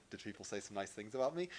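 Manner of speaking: mildly mock an insecure poster; fishing for compliments. Pastor teases the congregation's need for praise, quick and soft. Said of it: "It's like".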